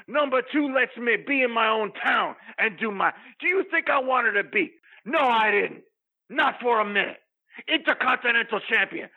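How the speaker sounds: normal talking voice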